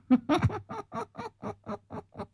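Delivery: very high shrill evil cackle; a screeching sorcerer laugh; villain laugh. Shown as a demonstration. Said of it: "điệu cười phản diện".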